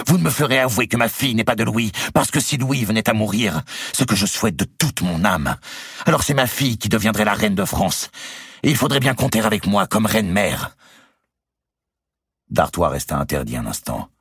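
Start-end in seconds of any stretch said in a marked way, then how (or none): 0.1-10.7 much higher, louder furious tirade; angry defiance. Acted. Marguerite defying Robert over her daughter, high angry tirade. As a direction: keep shouting your vow. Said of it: "poursuivit-elle".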